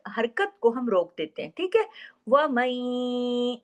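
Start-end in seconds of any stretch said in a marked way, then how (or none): 2.3-3.6 very slow chanted recitation; Quran recitation. Shown as a demonstration. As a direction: recite with idgham, drawn out. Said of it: "देखिए इधर काम हो रहा है ना". (धीमी तजवीद वाली तिलावत)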